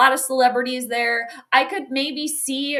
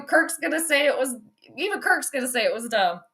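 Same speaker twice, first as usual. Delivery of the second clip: ordinary talk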